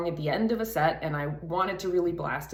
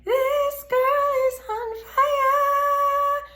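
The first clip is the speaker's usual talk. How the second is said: breathy head voice; 8 dB louder, much slower than usual. Shown as a demonstration. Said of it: "very light breathy sound".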